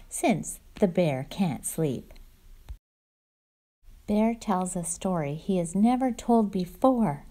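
cozy read-aloud storyteller voice; storybook read-aloud. Acted. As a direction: read it to the little ones like storytime. Reads picture book aloud.

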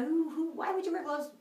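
high-pitched, scoffing self-quote; mock dismissive thought. Rider recalls brushing off gloves before the crash, mock dismissive. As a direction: pitch it up as a naive, dismissive question.

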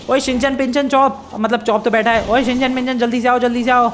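high, hurried summons; urgent calling out. Acted. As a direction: call the friends over in a high, pressed voice. Calling friends to the moving car.

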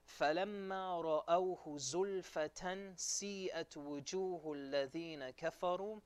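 formal scriptural recitation in Arabic; Quran recitation. Acted. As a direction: recite the verse with long, held syllables.

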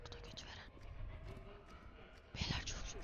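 tense unvoiced whisper; whispering while hiding. Real, not acted. Hiding from monster.

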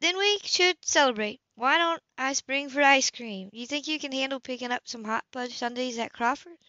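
lightly voiced Anna proposing ice cream; Anna, brightly voiced. A narrator's reading. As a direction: lift into Anna, treating the kid. Anna offering treat.

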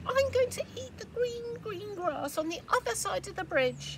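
eager little goat; squeaky little goat. Acted. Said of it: "said the little billy goat Gruff".

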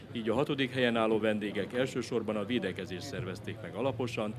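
ordinary delivery

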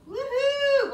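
high, loud whoop; gleeful teacher's cheer; excited cheer. Real, not acted. Cheering the children's success.

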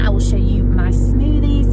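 normal talking voice

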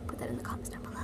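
a secret aside, whispered. Real, not acted. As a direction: whisper it to the viewers.

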